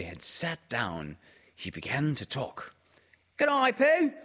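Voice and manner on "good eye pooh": very high, squeaky little voice; squeaked. A narrator's reading. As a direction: squeak it like a tiny excited joey. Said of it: "he squeaked".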